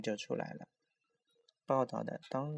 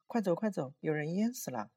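high, louder urgent cry; acted frightened alarm, pitch raised; panicked; 6 semitones higher, 4 dB louder than usual. A narrator's reading. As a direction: warn a friend while fleeing in terror. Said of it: "一脸惊恐的正往回跑".

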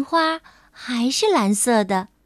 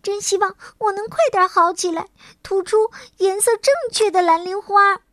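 higher, quicker fairy longing to get well; urgent and hopeful, pressing forward; eager; 7 semitones higher, faster than usual. Acted. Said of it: "花仙子急切地说".

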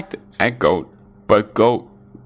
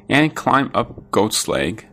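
usual speaking voice

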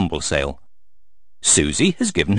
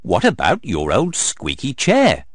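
usual speaking voice

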